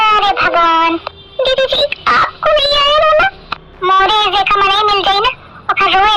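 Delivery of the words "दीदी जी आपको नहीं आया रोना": tearful whine. Acted. Asks after the tearful film.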